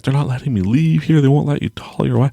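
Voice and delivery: high, mounting mock alarm; panicked inner voice. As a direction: act out a panicking mind, voice climbing. Retelling his mind's panicked thoughts.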